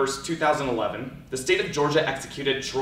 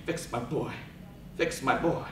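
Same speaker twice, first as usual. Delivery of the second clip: begging; 7 dB quieter than usual. Acted.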